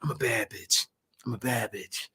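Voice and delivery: quiet mocking mantra. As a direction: mutter it like a private chant. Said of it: "what are you really telling yourself".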